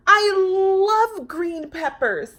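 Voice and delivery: high, loud, slow gushing; gushing delight. Acted. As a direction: swoon over peppers. Lisa delighted.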